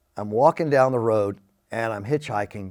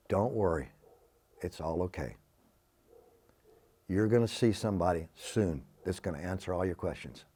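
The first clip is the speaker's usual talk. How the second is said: much softer, low and calm reassurance; comforting divine voice; 6 semitones lower, 10 dB quieter than usual. Retold or imitated. God's voice comforting a frightened young man, hushed and calm. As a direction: soothe him gently from above.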